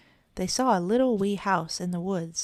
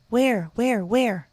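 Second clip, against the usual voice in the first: eager cries, higher and louder; excited cry; 5 semitones higher, 6 dB louder, much slower than usual. A narrator's reading. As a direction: blurt it out all in one breath. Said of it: "cried the three little kittens".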